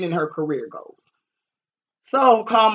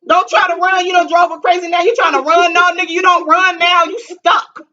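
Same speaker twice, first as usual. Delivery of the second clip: much higher and louder, angry scolding; telling him off; 13 semitones higher, 9 dB louder than usual. Retold or imitated. Scolding him on the phone for running off, loud and angry. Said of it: "I said".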